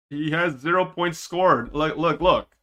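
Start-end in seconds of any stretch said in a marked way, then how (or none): none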